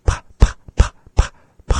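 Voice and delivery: voiceless p, p, p, popped loud; loud breathy p demo; isolated p. Shown as a demonstration. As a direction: spit out bare p sounds with a puff. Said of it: "really exaggerate those".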